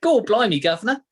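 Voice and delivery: high, loud mock cockney; teasing the British co-host with a mock cockney; mock cockney accent. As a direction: ham up a cockney gent. Mocking cockney stereotype.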